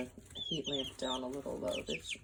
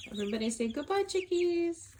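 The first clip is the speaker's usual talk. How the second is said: sing-song goodbye; 14 semitones higher, 6 dB louder than usual. Real, not acted. Saying bye to chicks, high.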